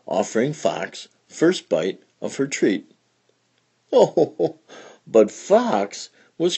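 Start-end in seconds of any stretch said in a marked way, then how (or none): none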